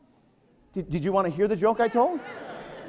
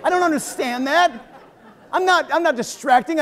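mock denial; 6 semitones higher than usual. Ironic denial, higher voice.

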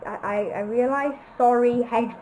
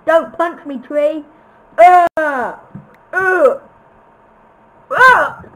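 mock fright; 4 semitones higher, 10 dB louder, slower than usual. Play-pleading with a tree.

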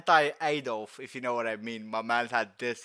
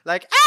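high loud maniacal laugh; Joker laugh; 17 dB louder than usual. Retold or imitated. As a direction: cackle like the Joker. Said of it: "I love his Joker laugh".

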